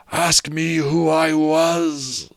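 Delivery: high, very slow, eerie ghost; slow ghostly voice. Acted. Marley's ghost challenging Scrooge, slow and eerie. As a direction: draw it out from beyond the grave.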